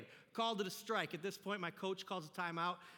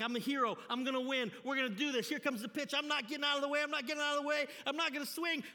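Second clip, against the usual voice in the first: higher, louder, climbing steadily; psyching himself up; 6 semitones higher, 4 dB louder, faster than usual. Retold or imitated. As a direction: pump yourself up as the pitch comes in. Acted hyped inner voice before pitch.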